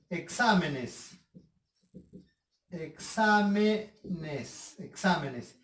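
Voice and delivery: very slow, stretched "Exámenes"; "Exámenes" sounded out slowly for a class; syllable by syllable. Shown as a demonstration. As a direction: draw out "Exámenes" in separate syllables. Breaking the word into syllables.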